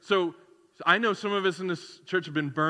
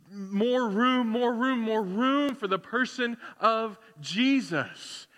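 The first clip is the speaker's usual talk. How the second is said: fervent plea; 5 semitones higher, 3 dB louder, slower than usual. Acted. Preacher pleading, building intensity.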